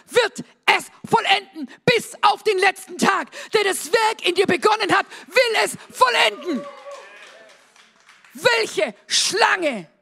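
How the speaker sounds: loud, high, indignant sermon challenge; forceful proclamation building to a shout; fired-up preaching. Real, not acted. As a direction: thunder the promise to the congregation.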